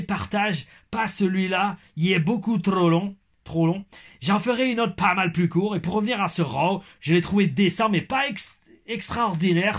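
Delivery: put-on Québécois accent. Reading the wrestler's comment aloud in a put-on Québécois accent. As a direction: read along in a hammed-up Québécois accent. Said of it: "mon accent québécois".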